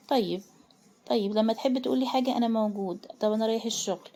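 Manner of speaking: usual speaking voice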